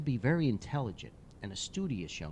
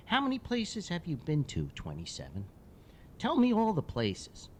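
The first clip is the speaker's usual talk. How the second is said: put-on curious little boy; 6 semitones higher than usual. Boy quizzing the hockey player about places, high and curious. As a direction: ask like a curious kid.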